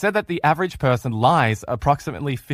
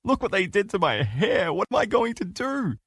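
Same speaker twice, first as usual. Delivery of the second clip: upset wife; 7 semitones higher than usual. Retold or imitated. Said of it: "and she said to you".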